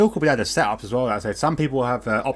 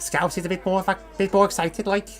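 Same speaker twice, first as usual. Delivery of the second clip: attempted Scouse; 8 semitones higher than usual. Shown as a demonstration. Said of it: "I can't do Scouse at all".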